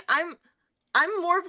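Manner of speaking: ordinary talk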